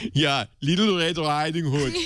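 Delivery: put-on villain Austrian accent. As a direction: read it in a hammy Austrian accent. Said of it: "Do the German voice".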